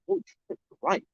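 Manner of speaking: squeaky high burst of amazement; mock amazed. Telling the podcast how he answered his barber, in a squeaky burst of mock amazement. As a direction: act out being floored by the barber's advice.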